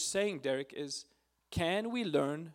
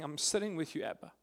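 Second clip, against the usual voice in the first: prayer; 2 semitones higher, 4 dB louder than usual. Acted. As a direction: start hushed, then pour out the fears louder. Voices his prayer to Abba.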